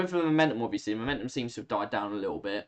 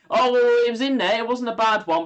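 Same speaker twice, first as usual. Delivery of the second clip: higher and louder, a sudden excited cry; excited outburst; 10 semitones higher, 8 dB louder than usual. Real, not acted. Streamer commentating a free kick that nearly scores, excited cry. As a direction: jump up as the ball nearly goes in.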